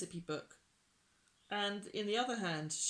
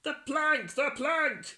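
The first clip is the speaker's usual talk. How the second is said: high, loud chanting yell; pirates' yell; 7 semitones higher, 9 dB louder than usual. A narrator's reading. Pirates call for the plank, yelling. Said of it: "yelled Ben Buckle and Percy".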